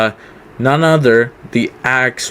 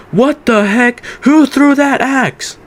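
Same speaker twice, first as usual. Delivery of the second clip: freaking out; 13 semitones higher, slower than usual. Retold or imitated. Owner yelling at the crowd about the smashed window, high outraged yell. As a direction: play the furious owner screaming at the crowd. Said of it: "he freaks out".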